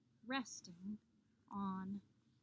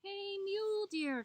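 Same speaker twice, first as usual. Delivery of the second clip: calling out; 9 semitones higher than usual. A narrator's reading. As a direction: hail a friend from afar. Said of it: "Coyote called out".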